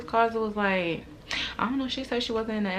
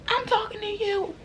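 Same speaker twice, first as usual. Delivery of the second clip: much higher, mocking reply; mocking girl. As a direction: mimic her talking back. Girl talks back.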